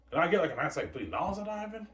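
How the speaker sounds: high wheedling ask; lightly voiced greedy director; greedy whine. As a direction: angle for a billion. Greedy director asking for money.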